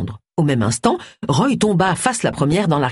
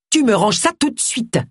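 sharp, higher-pitched command; ordering; 7 semitones higher than usual. A narrator's reading. Agatha tells Roy to put his phone away, commanding. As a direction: order it with no room for argument. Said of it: "ordonna Agatha".

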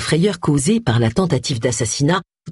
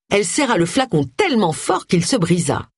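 usual speaking voice

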